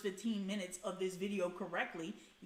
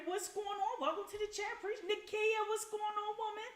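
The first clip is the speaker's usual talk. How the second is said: high greeting; 12 semitones higher than usual. Real, not acted. Greeting viewers in the chat.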